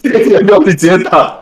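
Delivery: high, laughing words; laughing while talking. Real, not acted. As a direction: say it through laughter. Laughing while suggesting title. (边笑边说)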